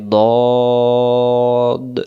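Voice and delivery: "দদ" very long and rounded, from the molars; heavy DAD. Shown as a demonstration. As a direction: hold a thick o in "দদ". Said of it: "মোটা করে পড়তে হয়".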